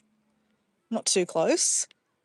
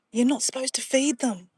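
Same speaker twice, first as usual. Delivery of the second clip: ordinary talk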